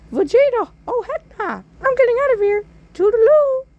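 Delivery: very high, squeaky clown voice; hammed-up high squeaky clown; bad Pennywise. Pennywise fleeing.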